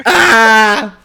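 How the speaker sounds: loud, high cry of frustration; groan of despair. Real, not acted. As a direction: let out a pained howl. His player gets drafted.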